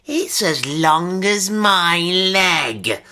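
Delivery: relishing the scare; taunting. A narrator's reading. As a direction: stretch it out to frighten him. Taunting Henry about the needle.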